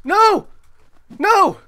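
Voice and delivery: high, loud outraged shouts; shocked disbelief. Real, not acted. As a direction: yell it like the kill was stolen. Enemy survives, disbelief.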